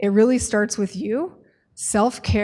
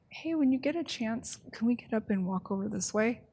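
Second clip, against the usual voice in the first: softer, easy, asking kindly; gentle invitation; 8 dB quieter than usual. Shown as a demonstration. Case manager gently inviting a client to walk over, soft. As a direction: invite the client, don't push. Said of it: "Totally different".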